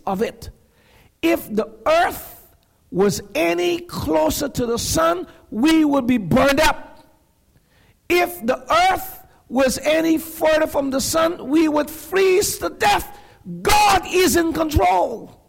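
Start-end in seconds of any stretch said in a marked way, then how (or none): 1.2-13.2 full-force preaching. Acted. 13.6-15.4 preacher's shout on "God is in control"; shouted declaration. Shown as a demonstration. Shouted climax of sermon.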